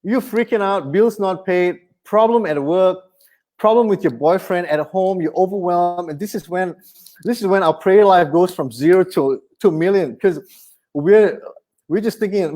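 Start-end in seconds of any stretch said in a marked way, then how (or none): none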